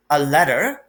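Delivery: ordinary delivery, nothing marked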